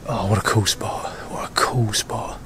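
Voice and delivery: soft awed whisper, slowing down. Real, not acted. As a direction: breathe it out quietly. Whispering at a hunting spot.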